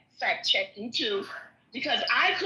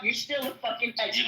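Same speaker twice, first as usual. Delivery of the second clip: normal talking voice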